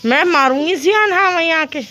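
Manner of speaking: scolding threat. Real, not acted. Mother threatens to come and smack, scolding.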